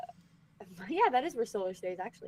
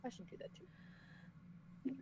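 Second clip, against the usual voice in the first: whispered aside; 24 dB quieter, much slower than usual. Real, not acted. Aside while muting phone.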